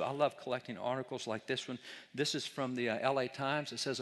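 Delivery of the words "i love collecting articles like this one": usual speaking voice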